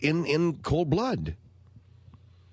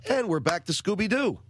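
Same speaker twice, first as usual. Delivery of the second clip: ordinary talk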